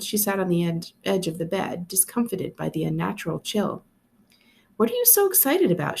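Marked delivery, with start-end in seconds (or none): 4.8-6.0 higher, questioning tone; big sister puzzled; curious sister. A narrator's reading. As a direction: ask her excited brother. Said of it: "she asked".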